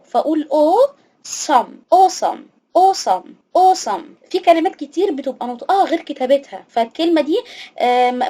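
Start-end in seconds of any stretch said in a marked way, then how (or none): 0.5-4.0 "awesome" with the first part said "o", "osam"; "awesome" stretched slowly, opening on a long "o". Shown as a demonstration. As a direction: say "awesome" as "o-sum". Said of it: "هنطقه كأنه أو".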